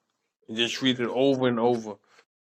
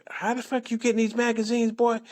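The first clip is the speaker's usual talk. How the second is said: high-pitched mock-mom interrogation; suspicious scolding mother; 9 semitones higher than usual. Mom questioning her boy about magazines, high scolding imitation. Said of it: "my mom used to be like".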